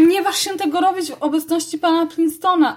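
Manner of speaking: put-on girl warning the mouse sharply. A narrator's reading. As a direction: forbid it firmly. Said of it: "ostrzegła Amy".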